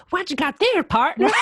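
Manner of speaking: high, loud, exaggerated Southern American twang; mock his hammed-up Southern American accent, laughing. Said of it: "didn't have to say it like".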